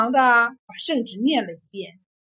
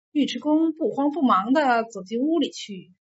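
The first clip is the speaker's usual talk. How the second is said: plain reading voice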